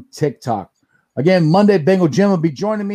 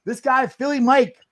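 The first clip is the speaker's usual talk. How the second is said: ordinary delivery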